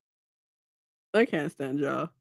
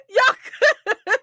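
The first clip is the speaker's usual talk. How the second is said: laughing reassurance; 11 semitones higher than usual. Retold or imitated. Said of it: "And I'm like".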